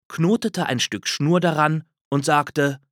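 usual speaking voice

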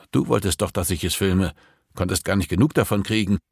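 usual speaking voice

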